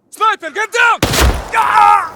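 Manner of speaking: screamed warning, then a cry of pain. Acted. Soldier warning his partner, then shot and screaming. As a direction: yell the warning and get hit. Comrade hit by sniper.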